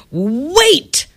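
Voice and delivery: mock call to stop him; playful story shout. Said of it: "shouted Elizabeth".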